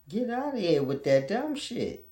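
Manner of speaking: high put-on voice, scoffing; mock Dutchman refusal. Vlogger voicing a Dutchman who rejects a British book, high mock scoff. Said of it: "he's like".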